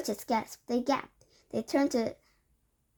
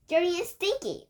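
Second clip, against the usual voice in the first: high, loud excited cry; worked-up cry; 8 semitones higher, 4 dB louder than usual. A narrator's reading. Witch audience crying out ever more worked up. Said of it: "cried the audience".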